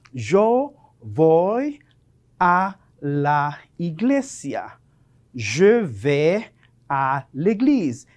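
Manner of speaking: slow teacher's model, word by word; drawn-out dictation pace with small pauses; slow example sentence. Shown as a demonstration. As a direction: space the words out for learners.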